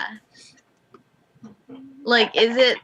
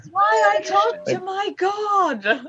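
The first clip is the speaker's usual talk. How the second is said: smug; 11 semitones higher, much slower than usual. Pretend. Player's character proud of god.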